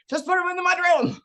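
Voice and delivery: high, pleading fed-up plea; half-joking exasperation at the shoes; exasperated mom. Retold or imitated. Exasperated mom voicing her thought.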